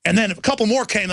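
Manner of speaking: ordinary delivery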